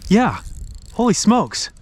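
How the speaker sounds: "Holy smokes" shot out high and quick; surprised. Real, not acted. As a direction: gasp it out on seeing the fish. Spotting a big smallmouth.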